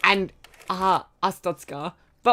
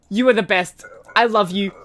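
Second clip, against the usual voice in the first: ordinary talk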